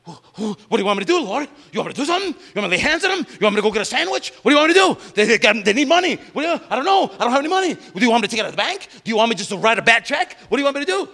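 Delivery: high, loud, rushed panic at God; frantic panic. Retold or imitated. Believer panicking at the Lord's question, frantic loud. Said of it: "you get frantic 'cause you don't know what to do".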